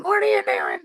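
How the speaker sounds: high, scandalised whine of a scolding Karen; mock Karen outrage. Commenters scolding a reckless fisherman, high mocking whine. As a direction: squeal it like an appalled busybody online. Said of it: "Chads and Karens going".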